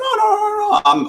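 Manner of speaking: high-pitched mock protest. Refusing to let the client pay, high protest. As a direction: squeak out a polite refusal. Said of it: "I said".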